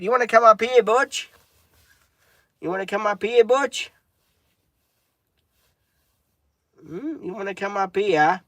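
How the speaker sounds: high, rising coaxing to a puppy; talking to a dog, high and coaxing. Real, not acted. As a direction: sweetly coax the dog up.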